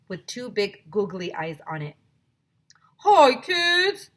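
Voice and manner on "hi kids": high, loud puppet voice. Acted. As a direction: greet the class as a sock puppet. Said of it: "said Mister Bongo".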